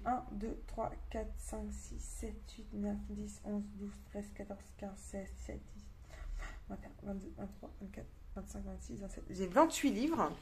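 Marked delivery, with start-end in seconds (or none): 0.0-9.2 counting to oneself. Real, not acted. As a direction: count quietly under your breath. Counting books to herself. (compter tout bas pour soi)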